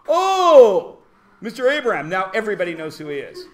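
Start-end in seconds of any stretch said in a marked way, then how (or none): 0.1-2.1 loud, high "oh" of recognition, then settling; hammed-up aha moment; mock sudden realization. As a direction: act out the penny dropping. Acting out sudden recognition of teacher.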